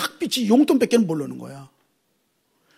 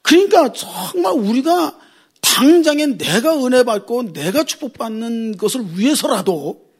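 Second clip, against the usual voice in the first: normal talking voice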